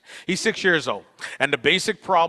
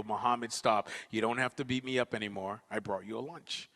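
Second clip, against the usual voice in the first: soft peace offering; 3 semitones lower, 8 dB quieter than usual. Retold or imitated. Child calmly offers bully lunch.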